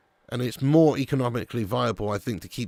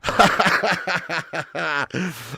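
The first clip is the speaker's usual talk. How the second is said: hearty laugh; 4 semitones higher than usual. Real, not acted. Laughing at his own rant.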